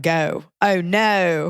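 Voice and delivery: Carolina accent with fronted, gliding O in "Go" and "no". Shown as a demonstration. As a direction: demonstrate the Carolina long O. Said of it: "a Carolina accent. They say that".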